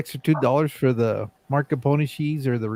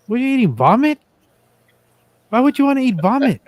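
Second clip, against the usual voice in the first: higher, louder teasing disbelief; mock disgust; 9 semitones higher, 5 dB louder than usual. Real, not acted. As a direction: rib a friend over gross food. Teasing friend eating on mic.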